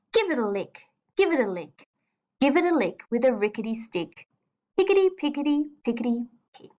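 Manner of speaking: brisk, bouncy rhythm like a stick on a fence; fast poem reading. Shown as a demonstration. Teacher demonstrating the fast rhythm to the class, brisk and bouncy. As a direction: rattle it off quickly, tapping out the beat. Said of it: "Listen to how I read this poem".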